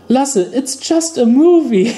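imitating mom. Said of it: "my mother looks to me and says".

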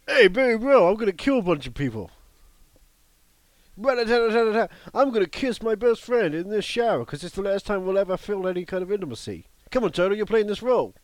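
loud, high, pushy bro voice; hammed-up movie dude. Host spoofing Elephant with the Entourage gang, hammed-up bro. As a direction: play a brash bro at full tilt.

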